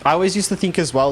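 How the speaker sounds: ordinary delivery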